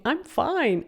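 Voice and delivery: imitated high-pitched protest; high, stressed. Coach quoting a sitcom character who insists he's fine, high and stressed. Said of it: "his voice tone goes really up, really high".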